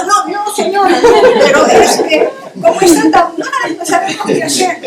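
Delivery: high, loud quoted plea broken by laughter; amused retelling of a frantic plea; laughing through quote. Retold or imitated. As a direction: laugh your way through the porter's begging. Porter's quoted plea, told laughing.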